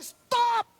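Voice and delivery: sudden high, loud shout; explosive preacher's shout; shouted command. Real, not acted. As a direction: fire it out as an order. Preacher commands sinners to stop.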